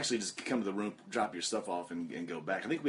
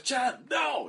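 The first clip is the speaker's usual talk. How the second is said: much higher mock scolding yell; playful telling-off from an old veteran; imitating Uno; 3 dB louder than usual. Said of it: "he goes".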